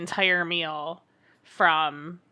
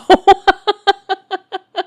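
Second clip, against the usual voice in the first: loud laugh; 12 semitones higher, 13 dB louder than usual. Real, not acted. Cohost laughing after joking about being in a bind. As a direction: burst of high loud laughter.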